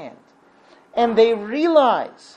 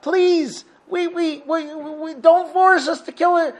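very high, loud, anguished appeal; desperate prayer; 8 semitones higher, 5 dB louder than usual. Retold or imitated. Cornered sailors pleading with God not to force them, desperate. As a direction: cry out to heaven, stammering as you beg.

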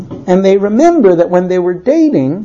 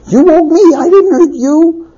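frustrated husband; 8 semitones higher than usual. Acted. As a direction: insist on blame, voice raised in pitch. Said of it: "he's super frustrated".

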